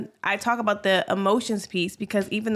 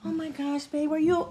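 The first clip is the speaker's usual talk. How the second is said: softer, sweetly doting pretend concern; mock tender; 5 semitones higher, 5 dB quieter than usual. Wife acting the tenderness she wanted, soft and sweet. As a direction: coo it like a doting partner, lightly mocking. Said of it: "it's not like a".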